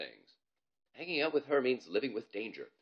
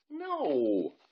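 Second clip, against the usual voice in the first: higher, louder no; scared boy refusal; 5 semitones higher, 6 dB louder than usual. Acted. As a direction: refuse in a panic. Bob refusing flatly.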